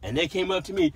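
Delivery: usual speaking voice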